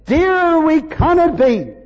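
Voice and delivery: loud, high, rising preacher's cry; fervent preaching. Acted. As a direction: lift the room with swelling praise. Preaching at full force.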